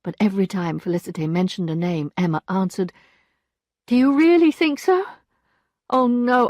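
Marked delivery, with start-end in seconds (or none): 3.9-6.5 high, loud, insistent; Emma's voice. A narrator's reading. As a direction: press the lawyer, high and firm.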